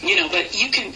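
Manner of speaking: usual speaking voice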